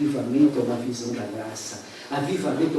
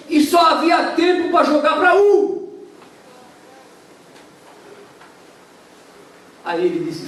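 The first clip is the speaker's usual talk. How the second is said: high, loud preaching at full force; fiery preaching; 5 dB louder than usual. Acted. Preacher tells the congregation the shipwreck story, fiery and loud. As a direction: storm the story out from the pulpit.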